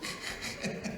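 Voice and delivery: very soft, voiceless mock snicker; Abraham's snicker. Preacher imitates old Abraham laughing to himself, hushed snicker. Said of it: "Abraham se rió".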